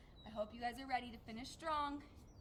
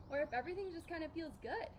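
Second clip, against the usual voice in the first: normal talking voice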